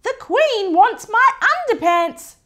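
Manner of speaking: high, loud excited shriek from Mum. Acted. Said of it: "shrieked Mum".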